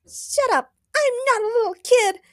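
put-on very high, squeezed girlish whine; pouting tantrum. A narrator's reading. Supergirl stomps around after being scolded, pouting rage. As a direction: protest with an angry pout. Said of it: "Supergirl pouted as she stomped around".

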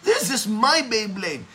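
loud, whiny mock-child protest; Dakila. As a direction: imitate an upset boy defending his toy. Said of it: "Then Dakila responded".

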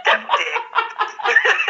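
said through laughter; giggling punchline; laughing. Real, not acted. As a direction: breathe it out laughing.

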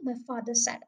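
ordinary delivery, nothing marked

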